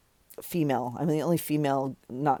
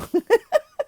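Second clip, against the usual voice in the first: high laugh; 5 dB louder than usual. Real, not acted. Laugh at own mistake.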